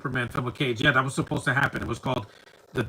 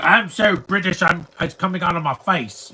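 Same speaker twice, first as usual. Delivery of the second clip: hammed-up British accent, higher and louder; mock British accent; 5 semitones higher, 6 dB louder than usual. Mocking the annoying character's British accent, hammed-up. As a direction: parody an over-the-top British accent. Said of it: "He's like".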